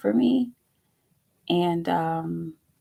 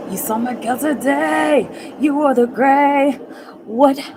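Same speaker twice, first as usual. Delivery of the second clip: high, loud, slowed gush of eager questions; excited gushing; 7 semitones higher, 9 dB louder, slower than usual. Real, not acted. Quizzing chat about the date, loud excited gush. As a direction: gush over the news, bursting to know.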